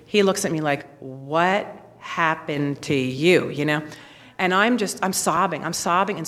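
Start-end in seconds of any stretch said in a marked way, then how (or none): 1.0-3.5 slow, low, drawn-out disbelief; low voice, stretched out in bafflement; stunned question. Retold or imitated. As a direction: imitate a dumbfounded husband, word by word. Husband sees her in tears.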